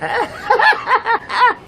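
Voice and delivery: high, rapid cackle; acted high-pitched burst of laughter; hammed-up ha-ha laugh; put-on laugh. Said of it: "And then he'll go".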